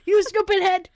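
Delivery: high, loud, laughing mock yell; hammed-up yelled insult. Co-host playing prophet insulting the king, hammed-up yell.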